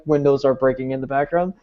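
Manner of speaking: ordinary talk, nothing marked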